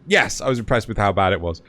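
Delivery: normal talking voice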